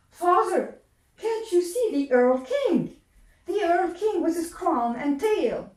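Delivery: put-on high, loud, frightened child voice; a scared boy calling out. Sick boy calls to his father, high and frightened.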